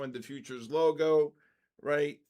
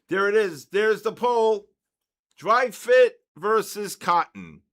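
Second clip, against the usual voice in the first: announcing; 5 semitones higher, 11 dB louder than usual. Real, not acted. Announcing the poll to chat.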